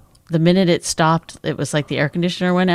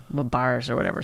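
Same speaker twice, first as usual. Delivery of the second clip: whispered aside; 5 semitones lower, 7 dB quieter than usual. Real, not acted. Drops to a whisper mid-story.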